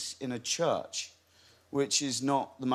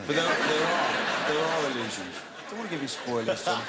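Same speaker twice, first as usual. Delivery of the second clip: laughing; 3 semitones higher, 3 dB louder than usual. Real, not acted. Laughing while teasing.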